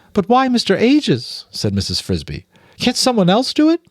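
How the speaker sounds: high, bewildered mother mouse; Mrs Frisby puzzled. Acted. Mother mouse to the rats, high and bewildered. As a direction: sound lost, pleading to understand.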